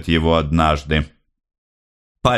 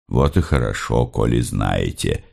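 Andrey's lower voice; 8 semitones lower than usual. Acted. Andrey replies to the mother.

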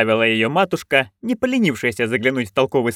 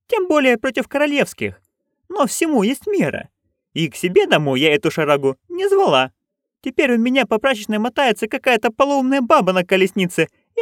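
put-on higher, eager old crone; Nanny Ogg voice; 13 semitones higher than usual. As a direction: pitch up into a gleeful old busybody. Nanny complains at length. (высокий азартный голос старой ведьмы)

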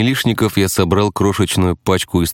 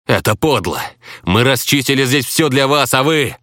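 higher-pitched, accusing outburst; indignant protest; 8 semitones higher than usual. A narrator's reading. Player accusing the others of foul play, indignant.

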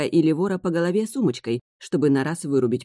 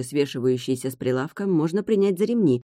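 usual speaking voice

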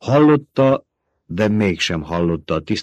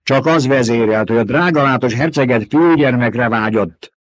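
higher, louder scolding; barking rebuke; 5 semitones higher, 6 dB louder than usual. Acted. Old woman snapping at Jessica over the duke's wish. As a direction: snap the accusation at her. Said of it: "förmött rá".

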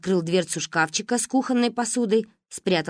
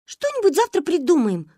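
put-on reassuring girls; 10 semitones higher than usual. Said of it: "хором успокаивали остальные".

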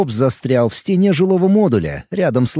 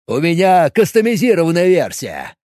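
blurted out; 3 semitones higher than usual. Acted. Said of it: "выпалил Боб".

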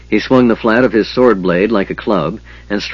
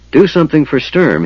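higher-pitched, mildly irritated order; irritable; 7 semitones higher than usual. A narrator's reading. Flint to Tass over wounded Sturm, irritable. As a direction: snap it with a touch of impatience. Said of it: "he said to Tass irritably".

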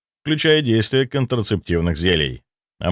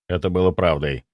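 plain reading voice

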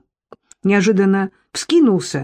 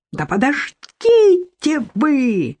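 yelling; 7 semitones higher, much slower than usual. A narrator's reading. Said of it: "завопила Софья".

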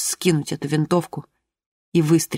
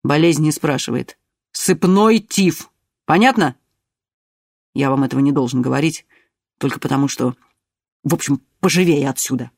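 stern official; 2 semitones lower than usual. A narrator's reading. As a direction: lay down the facts sternly. Pak speaks firmly.

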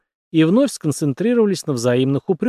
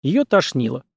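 usual speaking voice

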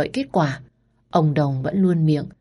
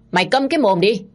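angry order; 5 semitones higher, 3 dB louder than usual. Acted. Wife snaps at the maid.

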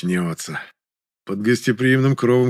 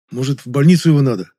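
raised, louder worried question; mild feigned concern; 4 semitones higher, 6 dB louder than usual. A narrator's reading. Жасминов asks about the hospital, imitating concern. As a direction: ask with a show of concern. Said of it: "с беспокойством спросил Жасминов".

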